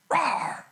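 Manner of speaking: put-on playful growling bark at a kid; mock bark. Pastor mimicking a grumpy old man's bark at him. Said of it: "he barked at me".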